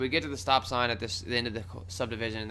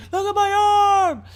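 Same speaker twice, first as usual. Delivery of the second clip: high, loud, stretched-out wail; screaming; 11 dB louder, slower than usual. Retold or imitated. Answering the cop with his injured arm, screaming. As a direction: scream it over the cop's question. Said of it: "I'm screaming about my arm".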